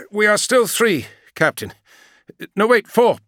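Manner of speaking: high, loud pilot voice; a crisp pilot calling in from the cockpit; gunship pilot. Acted. Pilot reports over the vox.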